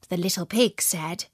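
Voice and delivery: ordinary delivery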